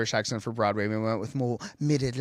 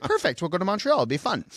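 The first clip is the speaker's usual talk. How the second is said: tag; 6 semitones higher, faster than usual. Retold or imitated. Mother's cheerful agreement acted out.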